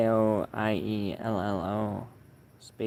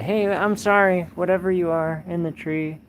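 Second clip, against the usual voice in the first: raised, higher voice thrown upward; calling out apology; 7 semitones higher, 6 dB louder than usual. Real, not acted. Streamer to whatever is in the tree, called-out apology. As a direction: call out to make peace.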